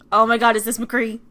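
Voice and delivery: loud, thrilled question; excited exclamation. Acted. Player spotting McCree, loud and excited. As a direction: blurt it out loudly in starstruck surprise.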